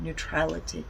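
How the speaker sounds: usual speaking voice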